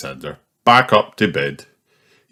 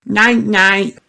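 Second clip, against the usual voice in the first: much higher piggy goodnight; Peppa snorting. Acted. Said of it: "snorts Peppa".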